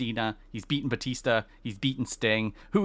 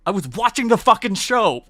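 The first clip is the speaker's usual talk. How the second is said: much higher and louder, fed up; exasperated outburst; 10 semitones higher, 8 dB louder than usual. Real, not acted. Host venting at a Twitter critic, loud exasperated outburst. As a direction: snap back at the critic who corrected her.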